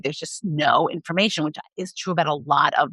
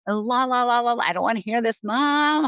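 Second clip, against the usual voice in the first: put-on high-pitched teenager blocking out the parent, singsong la la; half-sung la la la with a whiny Mom at the end; mock teen la-la-la; 6 semitones higher than usual. Teen tunes out a parent's talk, high singsong whine. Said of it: "They may say".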